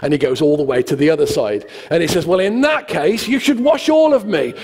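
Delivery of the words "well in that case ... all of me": high-pitched, over-eager disciple; hammed-up keen volunteering. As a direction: get carried away with the idea. Retold Peter eagerly overdoing it.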